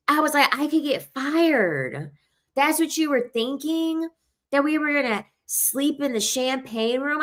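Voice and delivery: high-pitched, playful protest; mock indignation. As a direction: act scandalized, pitched well above normal. Acts out protesting to client.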